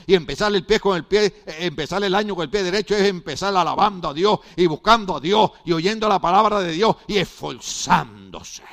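loud, rising preaching build; fiery sermon climax; full-force preaching. Acted. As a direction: preach it out to the congregation.

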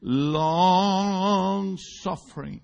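long suffering stretched very slowly in English; long suffering, slow and drawn. Shown as a demonstration. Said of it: "En inglés".

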